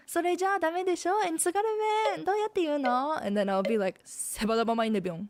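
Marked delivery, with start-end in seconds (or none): 0.1-3.2 rapid, high Tsugaru-ben Japanese; 早口で高めの津軽弁; Tsugaru dialect. Shown as a demonstration. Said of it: "I'm gonna give you an example sentence". 4.0-5.3 very fast Tsugaru-ben phrase; 早口の津軽弁; Tsugaru dialect. Shown as a demonstration. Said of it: "I'm gonna give you an example sentence".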